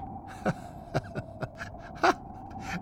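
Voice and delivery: high, soft laughter; amused chuckle. Acted. Henry enjoys his half-back joke, amused chuckle. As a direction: chuckle at your own joke.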